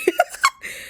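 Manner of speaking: very high giggle; short high laugh at something creepy; creeped-out laugh. Real, not acted. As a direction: squeal a quick uneasy laugh.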